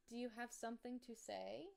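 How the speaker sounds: high, playful voice for the dog; talking to the dog. Real, not acted. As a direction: tease the dog in a sing-song voice. Talking to her dog Barkley.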